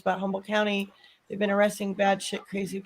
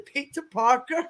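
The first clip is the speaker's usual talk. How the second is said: higher mock Indian accent; put-on Indian accent, pitched up; bad Indian accent; 4 semitones higher than usual. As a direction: repeat it in a clumsy Indian accent. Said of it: "worst Indian accent ever".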